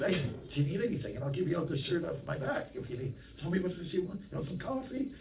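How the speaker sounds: jolly dealer. Retold or imitated.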